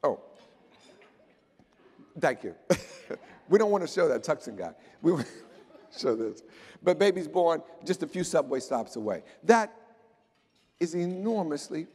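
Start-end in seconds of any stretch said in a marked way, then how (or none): 5.0-6.4 laughing through the words, fast; amused quick aside. Real, not acted. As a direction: chuckle as you correct yourself. Joking aside about a slide.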